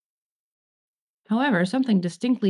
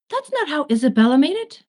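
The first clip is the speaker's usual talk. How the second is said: higher, louder offended protest; offended huff; 7 semitones higher, 5 dB louder than usual. Acted. Angel protests at baking show, offended and louder. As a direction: protest as if personally wronged.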